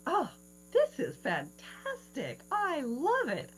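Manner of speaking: slow, wondering delight, rising high at the end. Retold or imitated. Dying woman in a flower field, slow delighted wonder. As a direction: savour the beauty of the meadow. Retelling joy in meadow.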